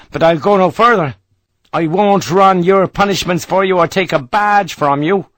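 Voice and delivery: an Irish rifleman refusing his officer's promotion; Irish soldier. Acted. Irish sergeant voiced by narrator.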